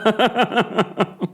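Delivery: short burst of high giggles; real high-pitched laughter; high giggling laugh. Real, not acted. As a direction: crack up after the punchline. Laughs at own quote.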